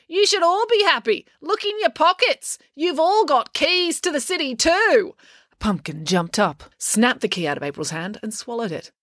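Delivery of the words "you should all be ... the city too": very high, exasperated address to a crowd; indignant. A narrator's reading. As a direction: tell off the crowd in a raised pitch. April yelling at booing crowd.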